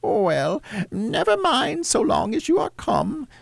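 high falsetto forgiving mother; Cratchit's wife. A narrator's reading. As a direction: reassure your daughter in a high motherly voice. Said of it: "said Missus Cratchit".

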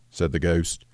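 usual speaking voice

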